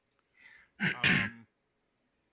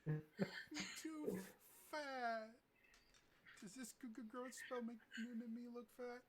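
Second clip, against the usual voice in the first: high-pitched character voice; 11 semitones higher, slower than usual. Acted.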